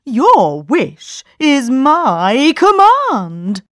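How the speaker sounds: slow, loud solemn genie; grand genie. A narrator's reading. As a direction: majestic servant genie. Genie speaks.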